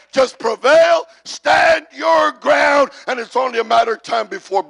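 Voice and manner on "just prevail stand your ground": loud, high preaching shout; rousing shout. Real, not acted. Preacher urging the congregation to stand firm, rousing shout. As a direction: stretch each word out and belt it.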